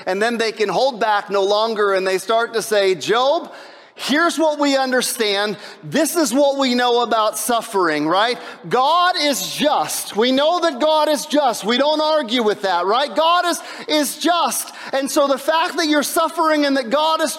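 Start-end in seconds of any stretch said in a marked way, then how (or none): 2.9-13.1 preachy know-it-all friends, raised and pushing; mocking the friends. Preacher voices Job's friends lecturing. 13.1-14.7 slow, high shout of God is just; insistent shout. Retold or imitated.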